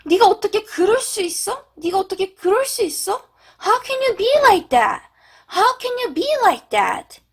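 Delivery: high-pitched, acted hurt accusation; 감정이 상한 듯. Shown as a demonstration. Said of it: "비슷한 맥락으로는". (배신당한 듯 높은 톤으로 원망하기)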